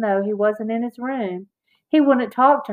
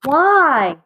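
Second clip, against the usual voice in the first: high, loud, angry burst; indignant raised voice, just short of a shout; almost shouting; 6 semitones higher, 5 dB louder than usual. A narrator's reading. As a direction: nearly shout it in protest. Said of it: "in an almost shouting voice".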